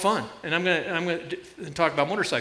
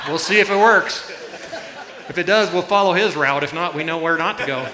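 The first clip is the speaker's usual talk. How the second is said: louder, words bubbling with laughter; laughing through it; 2 semitones higher, 5 dB louder than usual. Real, not acted. Pastor laughing to his congregation about letting Hollis test the route. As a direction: laugh while telling the joke.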